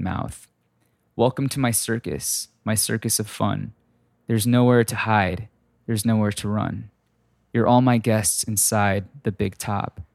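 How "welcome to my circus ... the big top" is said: ordinary delivery, nothing marked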